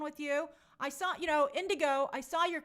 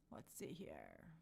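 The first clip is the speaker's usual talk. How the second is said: very low, soft Let's see here; a growly low Let's see here, quietly; mock deep voice; 18 dB quieter, much slower than usual. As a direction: drop into a silly deep voice. Scrolling chat, playful voice.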